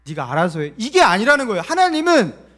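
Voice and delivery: loud, high full-force sermon; impassioned preaching. Real, not acted. Pastor urges belief that God gives strength, forceful preaching. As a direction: build to a ringing, high-pitched shout of conviction.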